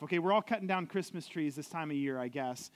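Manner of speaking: ordinary talk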